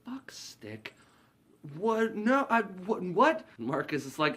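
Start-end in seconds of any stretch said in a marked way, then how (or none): 0.1-0.9 hushed, appalled whisper; straight-laced manager whispering the crude phrase; shocked whisper. Retold or imitated. Ritter repeats in shock. 1.8-3.4 high, sputtering confusion; prim manager sputtering at crude talk; flustered confusion. Retold or imitated. Ritter flustered.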